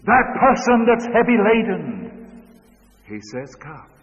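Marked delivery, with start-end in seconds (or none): 0.0-2.4 high, loud pulpit cry; ringing appeal to the burdened; preaching at full force. Acted. Emphatic preaching. 3.6-3.9 soft, hushed "Come."; Savior's quiet call; gentle invitation. Acted.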